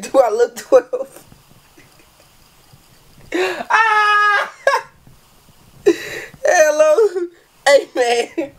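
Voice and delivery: very high, slow laughing squeal; screaming laughter. Real, not acted. Reading the punchline, screaming with laughter. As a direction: shriek with laughter.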